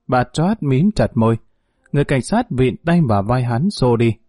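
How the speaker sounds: plain reading voice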